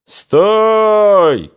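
high, loud, drawn-out halt call; shouted command. A narrator's reading. Cossack halting soldiers on the road, shouting. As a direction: shout it long and high to stop them. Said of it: "крикнул один из казаков".

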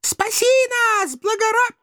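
very high damsel wail breaking off mid-word; interrupted high-pitched maiden plea; pleading, cut off. A narrator's reading. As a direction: start begging, then stop abruptly. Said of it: "стушевались нерейды".

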